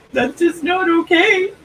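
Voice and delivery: mock crying. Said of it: "I was like".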